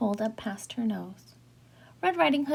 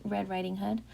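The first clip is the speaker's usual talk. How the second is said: plain reading voice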